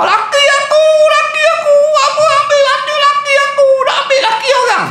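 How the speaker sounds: high, loud sing-song wail of a grieving wife; hammed-up keening over a lost husband; mock wailing widow. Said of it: "hak jenis meraung".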